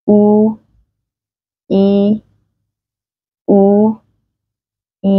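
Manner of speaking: U and I held long, mouth tensed; stretched vowel drill. Shown as a demonstration. Teacher shows the class a mouth warm-up, taut held vowels. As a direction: hold each vowel, U then I, separately. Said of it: "chuyển động miệng về vị trí âm U và âm Y căng".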